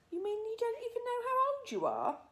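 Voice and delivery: put-on high, amazed girl's voice; a small girl astonished; little girl. A narrator's reading. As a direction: Sophie amazed at the giant. Sophie voiced.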